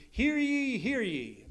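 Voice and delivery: "Hear ye" called out high, then dropping back; a light hint of a herald proclaiming; old town crier call. Shown as a demonstration. Preacher demonstrating emphasis by repetition, lightly mock town crier. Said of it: "like in Old English".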